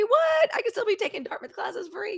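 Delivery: high-pitched, incredulous squeal; mock amazement. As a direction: go way up, playing astonished. Mock amazement at free classes.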